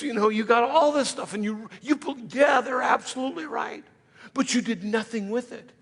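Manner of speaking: ordinary delivery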